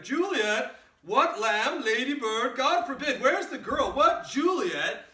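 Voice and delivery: high, loud calling yell, slowing on the last cry; yelling for Juliet. Acted. Nurse yelling for Juliet to come, high and loud. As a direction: shout through the house for the girl, slowing at the end. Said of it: "she's yelling for Juliet".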